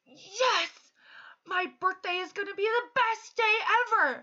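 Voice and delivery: put-on high, loud, bubbly child voice; child gushing to his speech teacher, excitedly; excited kid. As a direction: gush like a kid thrilled about his birthday. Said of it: "And he goes".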